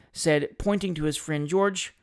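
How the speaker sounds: plain reading voice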